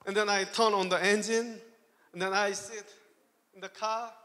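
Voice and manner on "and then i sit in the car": ordinary talk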